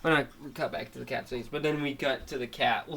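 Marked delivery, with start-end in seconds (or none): none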